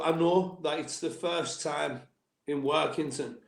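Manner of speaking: ordinary talk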